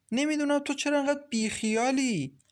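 high-pitched, exasperated reproach. A narrator's reading. One woman chides the other for not caring, exasperated. Sisters bicker over the landlord.

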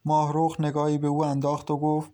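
usual speaking voice

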